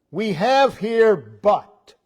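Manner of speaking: slow, loud, high, landing on BUT; emphatic reading. Retold or imitated. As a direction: punch each word, stop hard on but. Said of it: "It's used to emphasize".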